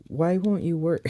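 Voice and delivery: exasperated, pleading with the toy; begging a stuck part to cooperate; frustrated. Real, not acted. Toy piece won't snap in.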